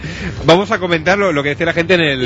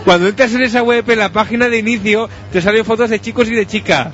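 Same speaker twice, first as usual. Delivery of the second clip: higher, declaimed read-aloud voice; forum post read-out; 4 semitones higher than usual. Acted. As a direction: read each post out like a soap recap. Reads forum posts aloud. (lectura declamada de mensajes del foro)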